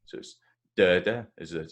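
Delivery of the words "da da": da-da, first da loud and strong; stress on first beat. Shown as a demonstration. Teacher showing the stress pattern, da-da with loud first beat. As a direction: punch the first da of da-da, second one light. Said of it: "so the stress is in the, at the start".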